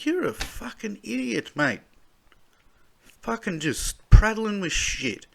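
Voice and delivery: high, angry ranting insult at the PM; furious outburst. Real, not acted. Reading the news, he insults the prime minister in a furious outburst. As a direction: spit it out in real anger.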